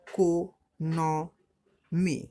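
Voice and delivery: "Economy" very slow, four separate beats; split into syllables. Shown as a demonstration. Teacher counting syllables for pupils, "economy" slowly split in four. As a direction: break it up as ই-কো-ন-মি with clear pauses. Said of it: "তো এটা কবার হচ্ছে? চারবার".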